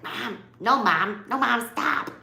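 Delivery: imitating a small kid yelling at mom; light hammed-up kid complaint; toddler Will Ferrell whine. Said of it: "he says it like Will Ferrell".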